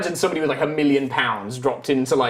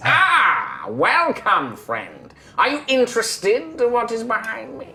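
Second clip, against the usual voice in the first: eerie skeleton; 8 semitones higher, slower than usual. Acted.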